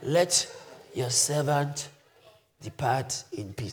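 soft, low, measured plea; a hint of frailty, resigned; lightly voiced weak old man. Old Simeon praying to God, lightly voiced as weak. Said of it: "Weak, but just".

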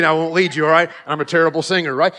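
normal talking voice

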